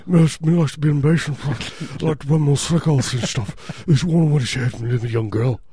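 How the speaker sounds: slurred, barely intelligible rocker; garbled rock frontman impression. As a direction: mumble it like an incoherent rock star. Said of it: "can't understand anything he says".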